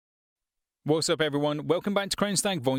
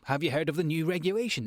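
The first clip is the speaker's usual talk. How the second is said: quick, higher, chatty question; lighter conversational read; 4 semitones higher, faster than usual. Shown as a demonstration. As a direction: ask it lightly, like chatting to a friend. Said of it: "It's a lot lighter in tone".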